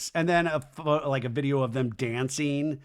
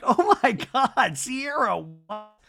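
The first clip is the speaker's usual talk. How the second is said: high, drawn-out mock shock; mock shocked gasping; 7 semitones higher, 4 dB louder, slower than usual. The whole group reacts to Sierra, exaggerated shock. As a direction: stretch it out high, aghast.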